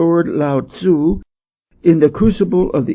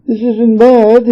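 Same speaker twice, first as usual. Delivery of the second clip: wistful; 8 semitones higher, 6 dB louder, slower than usual. A narrator's reading. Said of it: "said his chief assistant wistfully".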